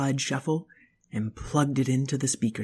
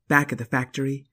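usual speaking voice

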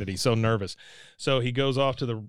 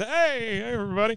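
higher, lightly voiced cheery hello; Bill greeting; 9 semitones higher than usual. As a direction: wave and greet the crowd. Bill waves at the speakers.

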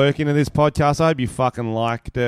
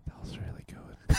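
whisper; 11 dB quieter than usual. Real, not acted. Aside during mock sign-off.

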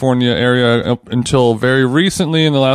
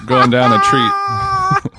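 laughing squeal; slower than usual. Real, not acted. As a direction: voice cracking high into laughter. Laughing while finishing the sentence.

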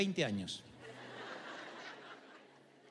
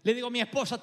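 normal talking voice

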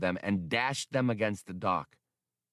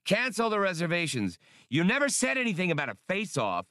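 panicked shouting; 9 semitones higher than usual. A narrator's reading. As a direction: shout it out in a rush. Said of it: "shouted Han".

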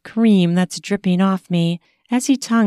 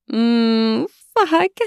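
aroused moan; 4 semitones higher than usual. Acted. Aroused narrator moans.

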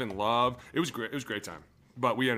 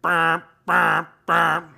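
loud, higher imitated alarm bleats; mock alarm beeps; 5 semitones higher, 11 dB louder, much slower than usual. Player imitating the hotel fire alarm, loud mock beeps.

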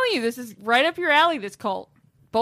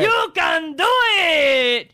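slow crying shout; 4 semitones higher, 4 dB louder, much slower than usual. Pretend. Mock cheer chant.

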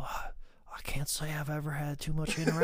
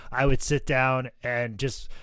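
ordinary talk, nothing marked

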